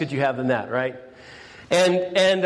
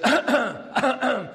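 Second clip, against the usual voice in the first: pointed throat clear; 7 semitones higher than usual. Retold or imitated. Sister clears her throat at Mary, mock pointed cough. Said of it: "she goes".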